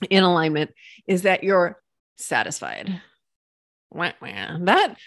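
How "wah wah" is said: mock wah wah. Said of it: "that word to me is flat and boring".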